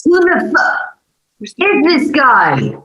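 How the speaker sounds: high, startled disbelief, slowing on the end; shocked outburst. Acted. Driver after the crash, shocked at the stranger, high and startled. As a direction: gape at the stranger by the wreck.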